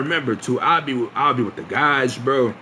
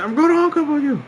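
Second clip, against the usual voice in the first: higher, louder, fast insistence; raised voice; 4 dB louder, faster than usual. Retold or imitated. Boy insisting to the girl who made him repeat it, raised voice. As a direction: snap it out fast and high, half yelling. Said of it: "So I yell at her".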